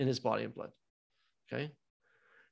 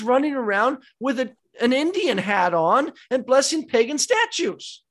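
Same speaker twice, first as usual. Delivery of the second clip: raised, higher and louder preacher's lament; fiery preaching; 10 semitones higher, 9 dB louder than usual. Acted. Priest warning his Bible study of a crisis, preaching at full force. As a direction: press the alarm on the congregation, slowing on the big lines.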